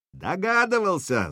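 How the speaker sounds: high yell over a crowd. Acted. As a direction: yell it back. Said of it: "заорал в ответ Сергей".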